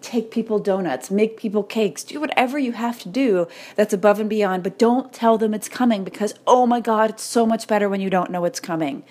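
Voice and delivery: normal talking voice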